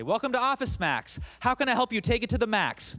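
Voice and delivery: high, loud chirpy store greeting; forced retail greeting. Retold or imitated. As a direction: chirp it like a cheerful cashier on autopilot. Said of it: "I had to say".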